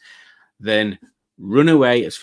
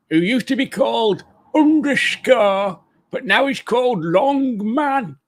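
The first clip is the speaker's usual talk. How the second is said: higher, silly roll-call voice; put-on silly voice; 8 semitones higher than usual. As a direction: read out the names in a high comic voice. Puts on voice reading out chat.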